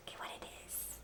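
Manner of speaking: breathy whisper to the class. Real, not acted. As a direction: hush them in to look.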